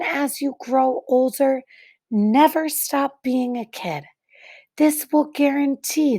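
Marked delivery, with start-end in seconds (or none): none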